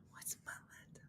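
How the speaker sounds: hushed question under her breath; whispered question. Real, not acted. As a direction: whisper the question as if asking yourself.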